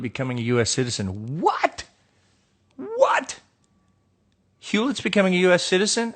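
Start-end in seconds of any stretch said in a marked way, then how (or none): 1.4-3.4 high squeaky disbelief; genuinely thrown, pitch leaps way up; stunned double take; shocked what. Real, not acted. Shocked by citizenship question. 4.6-6.1 higher, faster disbelieving question; can't believe what he's reading; mock outrage at the news; incredulous. Real, not acted.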